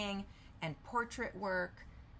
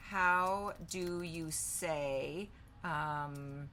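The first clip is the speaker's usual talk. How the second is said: slow, drawn-out words; spaced out at typing speed; dictating while typing; much slower than usual. Real, not acted. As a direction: say it slowly as you type it. Typing a question while saying it.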